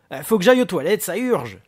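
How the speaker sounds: loud, high, pressing plea; agitated, bursting outburst; desperate urgency. Acted. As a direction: plead urgently for the toilet. Booker desperate for the toilet.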